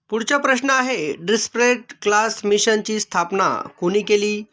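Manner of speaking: usual speaking voice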